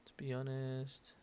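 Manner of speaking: honest drawn out softly; slow, soft "To be honest", left hanging; singsong lead-in. Shown as a demonstration. Teacher showing another opener, slow singsong. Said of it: "Ese cantadito".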